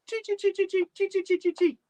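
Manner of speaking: very high, quick chattering squeak; tiny squirrel chirping "chee" over and over. Acted. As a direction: chitter like a teasing squirrel. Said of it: "said the squirrel".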